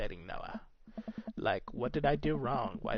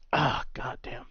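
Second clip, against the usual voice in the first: strained voice; 4 dB louder, slower than usual. Real, not acted. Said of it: "this voice, it's hard to keep up".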